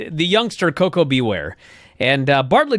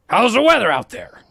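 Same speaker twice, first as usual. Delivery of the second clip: Vince imitation, bigger; 6 semitones higher than usual. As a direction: say it again with more showman push. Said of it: "and Vince goes".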